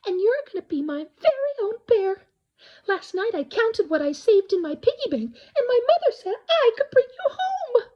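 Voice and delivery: put-on a small girl begging her mother; excited little girl. A narrator's reading. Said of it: "she said".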